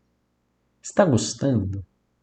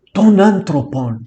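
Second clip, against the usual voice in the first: louder, higher burst on the Greek words; sudden outburst; 4 semitones higher, 5 dB louder, slower than usual. Acted. Teacher acting a stuttering Greek's sudden utterance, louder outburst. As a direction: blurt out "ton anthropon", drawing it out. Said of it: "de súbito".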